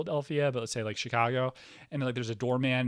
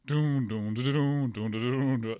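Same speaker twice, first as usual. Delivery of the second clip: ominous doom chant; slower than usual. Retold or imitated. Mock ominous castle music.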